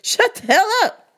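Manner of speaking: high, loud fed-up cry; telling off the loud customer, high and loud; exasperated outburst. Retold or imitated. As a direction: blow up at her in frustration. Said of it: "I was like".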